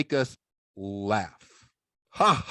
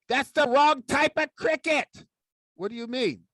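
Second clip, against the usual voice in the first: high correcting puppet voice; puppet sidekick; 9 semitones higher than usual. Acted. Puppet sidekick corrects Dave about cricket, high. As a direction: correct him like a cheeky puppet.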